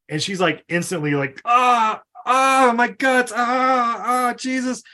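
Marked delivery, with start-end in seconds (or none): 1.5-4.8 high, loud, slow wails of stomach pain; groaning in pain. Retold or imitated. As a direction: moan out the pain like poison is burning. Poisoned woman clutching her guts.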